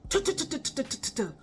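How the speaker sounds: quick run of spoken knocks; imitated door pounding. People beating on the classroom door, rapid mimicked knocks. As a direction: tap out knocking with the tongue. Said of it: "people beating on the door".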